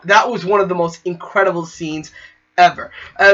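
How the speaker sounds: ordinary talk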